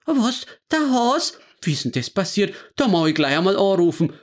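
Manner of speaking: high, shocked gasp, then hurried; startled old wife rushing to the phone; grandma hearing the news from her husband, alarmed. Acted.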